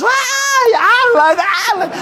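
very high mock fangirl squeal; chillido de admiradoras. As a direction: squeal like swooning fans. Chicas bonitas acosan a Alan. (chillido agudo de fans emocionadas)

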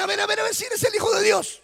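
high, quick, taunting tempter; tentador desafiante. Acted. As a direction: goad him insistently, pitched well up. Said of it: "y a decirle". (tentador burlón, agudo y rápido)

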